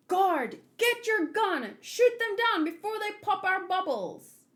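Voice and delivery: high, loud soldier barking an order; commanding guard. Acted. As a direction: yell the order to open fire at the crows. Voicing a manga guard.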